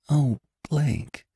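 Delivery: high, soft stricken cry; exclamation. A narrator's reading. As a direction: catch your breath at the news. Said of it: "exclaimed Jane".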